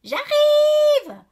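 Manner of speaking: very high, loud yell back; big sister's high-pitched holler; older sister bawling. A narrator's reading. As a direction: bawl it from another room. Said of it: "braille ma sœur aînée".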